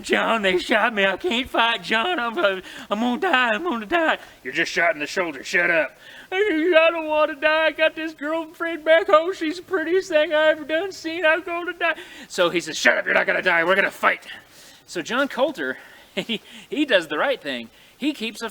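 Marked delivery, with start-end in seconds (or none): 0.0-4.2 whining. Acted. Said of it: "he starts whining". 4.4-6.0 louder, impatient telling-off; annoyed snap. Acted. Coulter snaps at crying partner. 6.3-11.9 whining. Acted. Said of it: "he starts whining". 12.8-14.3 loud, forceful command; firm shout. Acted. Coulter rallies panicking partner.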